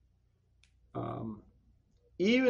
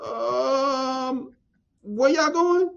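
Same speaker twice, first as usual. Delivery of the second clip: high unsure voice asking back; hesitant surprised question; 7 semitones higher, much slower than usual. Retold or imitated. Retelling classmates' question, high and unsure. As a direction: sound caught off guard. Voicing themselves unsure in story.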